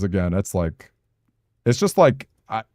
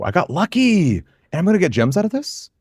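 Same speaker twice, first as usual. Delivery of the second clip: high, excited yelp; thrilled at the luck; 10 semitones higher than usual. Real, not acted. Excited at lucky draw.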